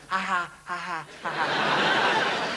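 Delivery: spelled-out ha ha ha, jeering; mock taunting laugh. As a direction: taunt them with a fake laugh.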